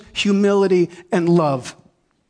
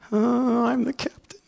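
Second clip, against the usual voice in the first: reluctant admission; 7 semitones higher than usual. Retold or imitated. Reluctant acceptance.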